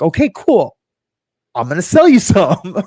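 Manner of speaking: mock sales pitch, punched up high; eager Amway recruiter. Recruiter closing on a friend in a reenactment, hammed-up eager. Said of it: "reenactment of, you know, ninety".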